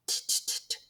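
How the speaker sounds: "T-t-t-t-" as soft voiceless t taps; whispered t-t-t. Shown as a demonstration. Teacher stressing the quiet t before tool. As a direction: tap the t silently, no voice. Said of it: "when to be quiet".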